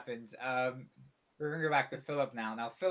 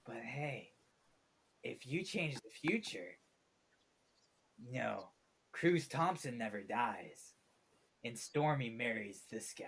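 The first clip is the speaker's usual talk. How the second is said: whispering voice; 3 semitones lower, 6 dB quieter than usual. Acted. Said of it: "you hear a voice in your head go".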